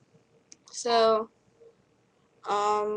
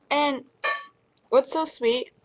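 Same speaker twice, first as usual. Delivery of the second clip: ordinary talk, nothing marked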